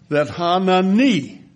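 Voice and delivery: "Hanani" said slowly, ha-na-NI, with the stress on NI; last-syllable stress. Shown as a demonstration. Pastor teaches the congregation to stress the last syllable. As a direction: lean on the final NI of Hanani. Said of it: "accent on the last syllable".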